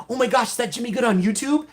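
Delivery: imitating the hype man's excited shout; excited fan. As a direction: hype it like a fan spotting a YouTuber. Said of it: "he would run over to me like".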